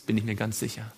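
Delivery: usual speaking voice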